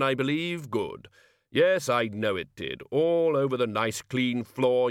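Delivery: hearty Santa-like Hogfather, jovial and booming; a big festive gift-giver cheering a child; jolly Hogfather. Acted.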